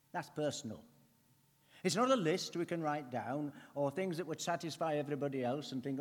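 ordinary delivery

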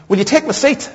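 high-pitched lady offering her seat; imitated polite offer. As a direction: offer it as a question, voice lifted high.

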